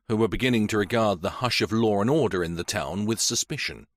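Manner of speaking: ordinary delivery, nothing marked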